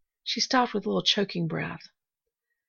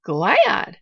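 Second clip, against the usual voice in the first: louder, startled question; surprised gasp; 9 dB louder than usual. A narrator's reading. Nancy interrupting the girl's talk of gladness, surprised. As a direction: blurt it out in surprise. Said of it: "gasped Nancy, surprised to an interruption".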